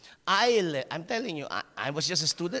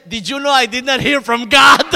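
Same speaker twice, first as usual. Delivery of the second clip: loud, higher comeback; mock retort; 6 semitones higher, 14 dB louder than usual. Girl mocking the preacher's claim about God, loud retort. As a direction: throw his words back at him.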